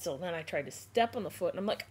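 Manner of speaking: normal talking voice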